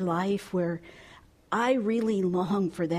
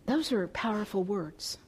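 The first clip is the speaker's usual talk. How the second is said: normal talking voice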